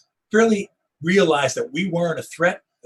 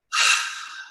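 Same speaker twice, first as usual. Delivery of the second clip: long impatient sigh, mimicked; imitated sigh. Wife in the back of the vehicle, mimicked weary sigh. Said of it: "then I hear".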